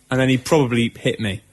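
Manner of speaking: ordinary talk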